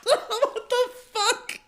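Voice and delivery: squeaked high through laughter, slow; laughing disbelief. Real, not acted. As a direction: choke it out mid-laugh.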